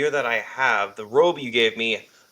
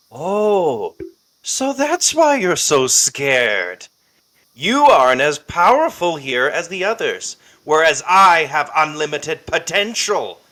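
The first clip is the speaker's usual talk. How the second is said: loud, confident taunt at the villain; louder, mocking the scared foe; defiant hero sneering at the villain; 3 semitones higher, 6 dB louder than usual. Player voices his character Clive.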